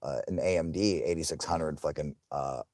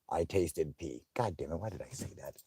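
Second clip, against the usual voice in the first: ordinary talk, nothing marked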